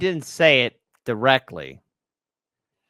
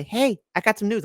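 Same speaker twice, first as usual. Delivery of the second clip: higher-pitched bird bringing news; little bird; 8 semitones higher than usual. Acted. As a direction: lightly voice a bird with a tidbit. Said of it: "You should have, like, a little bird".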